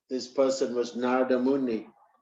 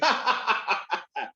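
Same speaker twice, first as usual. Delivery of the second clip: higher, laughing scorn; mocking laugh; 5 semitones higher, slower than usual. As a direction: laugh him off as a fool. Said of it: "the Brahmin started laughing".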